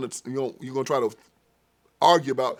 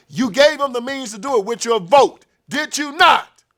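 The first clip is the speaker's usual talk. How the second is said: higher and louder, building to a booming accusation; accusing judge; 11 semitones higher, 9 dB louder than usual. Acted. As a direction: press him harder until the last words ring. God accusing voter, rising.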